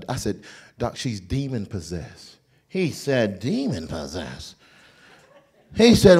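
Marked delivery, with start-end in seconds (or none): 0.8-2.4 low slow she's demon possessed; grave. Retold or imitated. Usher solemnly telling the doctor the diagnosis. 3.4-4.6 very low slow demon possessed; incredulous doctor. Retold or imitated. Doctor echoing the usher's diagnosis in disbelief.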